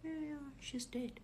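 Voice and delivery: hushed, composed reply; calm. Retold or imitated. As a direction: imitate a daughter calmly confirming. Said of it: "My daughter is calm".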